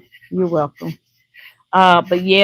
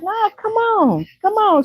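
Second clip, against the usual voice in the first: eager invitation; 13 semitones higher than usual. Retold or imitated. Imitating the neighbor waving her in, high. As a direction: call her in like an excited host. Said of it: "she was like".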